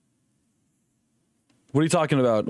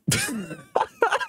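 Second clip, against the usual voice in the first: high laugh; 3 dB quieter than usual. Real, not acted. Big laugh on stream.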